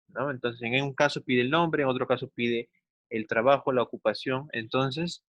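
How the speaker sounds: usual speaking voice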